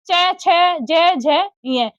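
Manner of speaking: च, छ, ज said very slowly, spaced apart; teacher slowly repeating the letters for pupils; slow sounding out. Shown as a demonstration. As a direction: spell out each letter slowly. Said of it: "फिर से बोल रही हूँ".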